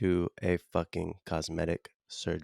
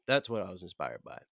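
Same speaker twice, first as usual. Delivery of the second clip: normal talking voice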